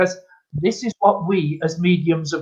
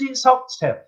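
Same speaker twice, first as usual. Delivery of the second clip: retold protest; 8 semitones higher than usual. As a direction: protest in a high, small voice. Retold insisting to doubting customer.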